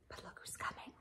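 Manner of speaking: hushed picture-book aside; whispered aside. Real, not acted. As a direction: lean in and whisper about the picture.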